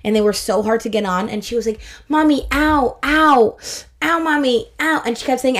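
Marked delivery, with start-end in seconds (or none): 2.1-5.0 put-on high-pitched little kid ows; imitated toddler complaint. Toddler to mommy while Uggs go on, pained complaint. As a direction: mimic a child protesting, pitched up.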